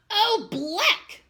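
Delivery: high, loud disgusted bleat. Acted. Chester grossed out by worms, loud high bleat. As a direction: spit out the disgust. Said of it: "bleated Chester".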